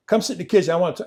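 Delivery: mock drunken demand; raising hell. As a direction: order her over, imitating a drunk husband. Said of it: "raising hell".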